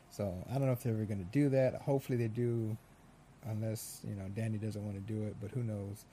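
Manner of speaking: ordinary talk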